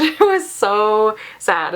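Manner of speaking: slow, laughing through the words. Real, not acted. Reader laughing at how sad the book was, laughing slowly. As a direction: let a real laugh spill into it.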